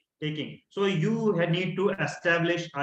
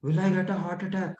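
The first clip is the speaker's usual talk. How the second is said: closed-off, worried asking; anxious, quick and small; fearful; 3 dB quieter, faster than usual. Shown as a demonstration. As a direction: shrink into the question, fearful. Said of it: "The body language is".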